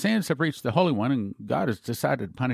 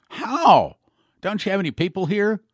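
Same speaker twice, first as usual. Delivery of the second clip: shocked outcry; 7 semitones higher, 6 dB louder than usual. Retold or imitated. Dimitri shocked by the angel.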